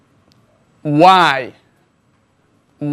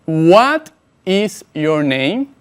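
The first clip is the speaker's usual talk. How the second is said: each word spaced out slowly for the learner; slow model sentence; much slower than usual. Shown as a demonstration. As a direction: say it slowly and clearly, like a teacher modelling. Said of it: "presta mucha atención". (pregunta modelo, lenta y clara)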